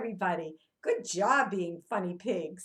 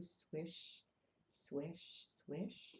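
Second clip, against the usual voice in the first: whispered swish; 7 semitones lower, 16 dB quieter, much slower than usual. Acted. Teacher makes fish tail sound.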